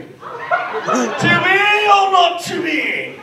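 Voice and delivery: loud, drawn-out theatrical delivery; Shakespearean declamation. Acted. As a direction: declaim it grandly like a stage Shakespearean, very slow. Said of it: "I feel like Shakespeare".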